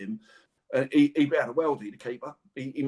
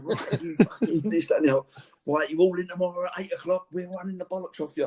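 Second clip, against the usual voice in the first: mock rant, higher and slowed at first; fuming manager; 6 semitones higher than usual. Footballer imitates the fuming manager threatening the team, mock rant. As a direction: ham up the raging manager a little. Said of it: "he's fuming".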